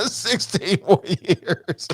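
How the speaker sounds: repeating it through laughter, slow; laughing. Real, not acted. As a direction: laugh it out slowly. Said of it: "sounds like a death sentence".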